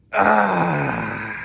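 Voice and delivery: loud stretching groan up high. Shown as a demonstration. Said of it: "maybe we're stretching".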